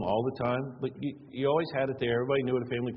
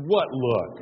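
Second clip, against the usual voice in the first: 1 indignant surprise; 3 semitones higher, 8 dB louder than usual. Retold or imitated. Dad reacts to the kids.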